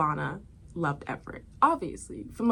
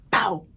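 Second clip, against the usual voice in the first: gunshot sound; 6 dB louder than usual. Retold or imitated.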